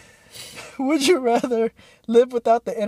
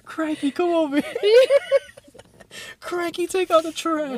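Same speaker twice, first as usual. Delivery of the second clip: higher, slower mock Australian dad voice; hammed-up Australian parent calling a kid; Steve Irwin Australian accent; 10 semitones higher, slower than usual. Steve Irwin imagined scolding his son, mock Australian accent. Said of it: "Call me Steve Irwin".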